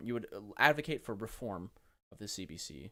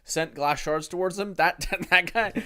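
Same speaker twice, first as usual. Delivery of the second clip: laughing disbelief; 5 semitones higher, 11 dB louder than usual. Real, not acted. Laughing at clip about Harris.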